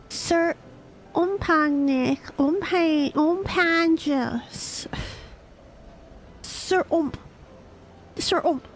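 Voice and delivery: put-on a young squire explaining gently; young companion voice. Companion recounting Sid's past.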